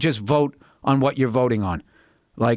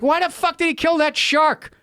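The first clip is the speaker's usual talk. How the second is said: mock baffled viewer; 12 semitones higher than usual. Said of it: "you're like".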